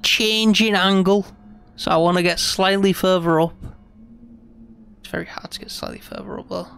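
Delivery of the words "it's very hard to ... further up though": under the breath. Real, not acted. Straining to climb higher.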